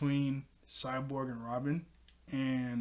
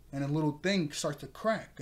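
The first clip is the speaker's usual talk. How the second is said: usual speaking voice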